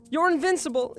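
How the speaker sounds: pleading denial. Acted. Repeated denial.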